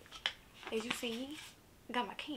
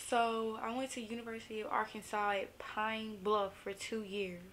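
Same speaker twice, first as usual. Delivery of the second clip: usual speaking voice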